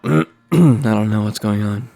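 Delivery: ordinary delivery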